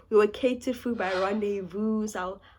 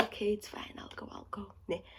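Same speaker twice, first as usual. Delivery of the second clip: soft whispered reluctant yes; worn-out whisper agreeing to go; tired whisper; 14 dB quieter than usual. Retold or imitated. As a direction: whisper it like you're too tired to argue. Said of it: "So I'm like".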